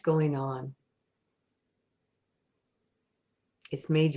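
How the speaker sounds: soft, unhurried meditation voice with long pauses; guided meditation. Acted. Meditation leader guiding listeners on an imagined ocean walk, soft and calm. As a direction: lead listeners gently inward, leaving space after each thought.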